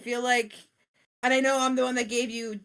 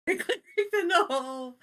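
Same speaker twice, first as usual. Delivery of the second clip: laughing through the words; 6 semitones higher than usual. Real, not acted. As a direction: giggle the clerics in the hole. Repeats it laughing.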